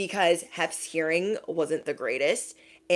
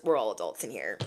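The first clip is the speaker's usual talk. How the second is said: lower, quiet "we're all adults"; quiet aside; 8 semitones lower, 7 dB quieter than usual. Shown as a demonstration. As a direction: mutter it softly.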